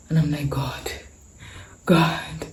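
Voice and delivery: hushed, unvoiced whisper to God. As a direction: breathe it out in a whisper. Alone in parlor, in shock.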